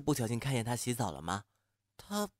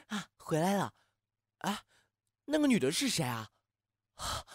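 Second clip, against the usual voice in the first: ordinary talk, nothing marked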